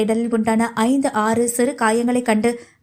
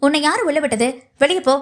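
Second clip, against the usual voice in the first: higher-pitched, annoyed rebuke; sharp dismissal; 4 semitones higher than usual. Acted. Annoyed nurse driving the visitor out, sharp and scolding. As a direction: order her out crossly. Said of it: "என்று விரட்டிவிட".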